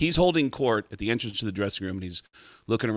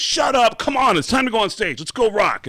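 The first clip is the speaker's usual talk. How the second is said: high, loud brushing-off voice; drummer voice; 10 semitones higher, 6 dB louder than usual. Retold or imitated. Drummer brushing off his bandmates, high and loud. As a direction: push it high and loud like you think they're kidding.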